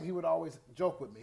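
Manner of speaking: ordinary talk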